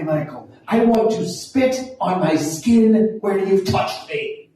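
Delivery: an attempted Ulster Irish accent, offended and cutting. Kitty berating Michael for refusing her father's favor, in an Irish accent. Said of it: "I can't do the accent, but I'll do my best".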